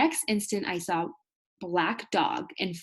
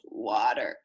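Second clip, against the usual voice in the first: croaked; 8 semitones lower than usual. Acted. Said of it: "he croaked".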